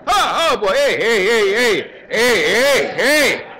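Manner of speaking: loud playful hey, hey; mock fight shouting. Fending off three against one, loud play-acted shout. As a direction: shout hey at the pretend gang.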